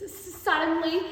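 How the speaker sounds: plain reading voice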